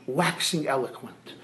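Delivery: normal talking voice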